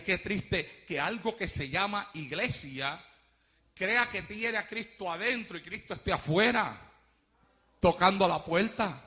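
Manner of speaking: ordinary talk, nothing marked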